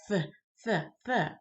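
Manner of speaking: "th, th, th" with tongue between the teeth; lisped s. Shown as a demonstration. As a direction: repeat a bare "th" in place of s. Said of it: "They kept saying".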